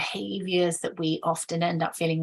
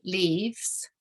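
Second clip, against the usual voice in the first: normal talking voice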